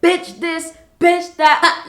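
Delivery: high, loud chanted cursing. Retold or imitated. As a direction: mimic someone yelling curses through a window. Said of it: "cursing me out with her head through the window".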